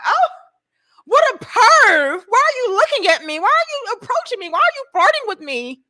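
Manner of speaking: high, loud indignant accusations; mock outraged woman. Woman accusing the man who approached her, mock outraged. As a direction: imitate a scandalized woman firing off shrill questions.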